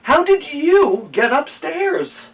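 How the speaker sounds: high, loud, slow playful question; amused watchman. Acted. Watchman asks the bear, high slow teasing question. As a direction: ask it slowly, teasing the bear.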